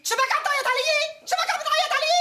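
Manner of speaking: high, loud protest at her husband; indignant shout. Acted. Wife shouting back at her husband, indignant. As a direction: throw his yelling back at him.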